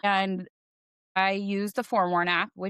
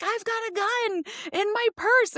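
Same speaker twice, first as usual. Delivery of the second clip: high, frantic retold confession; 14 semitones higher than usual. To the range staff at the counter, a frantic high confession. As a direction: gasp it out at the counter in a panic. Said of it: "and I'm like".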